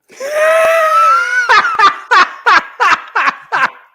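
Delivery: long, high, very loud laugh; loud cackle. Real, not acted. Comic cracks up at his own punchline, loud high cackle. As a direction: explode into a shrieking cackle.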